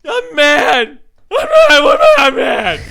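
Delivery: high shouted whiny mock tantrum; mocking de Blasio. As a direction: wail it up high and loud. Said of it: "This is de Blasio".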